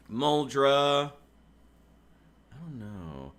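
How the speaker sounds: ordinary talk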